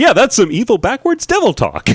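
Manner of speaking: cheery TV host voice; cooking show host. Retold or imitated. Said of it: "in a, like, a real pleasant, "I'm on a cooking show" voice".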